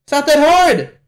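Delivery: high, loud burst of impatience; exasperated yell. Real, not acted. Viewer urging May to grab the stone, exasperated yell. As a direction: shout it in frustration.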